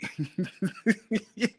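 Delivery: real high-pitched giggling fit; high giggle. Real, not acted. Man amused by his own example, giggling. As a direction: crack up in quick high bursts. Cracks up at the misreading.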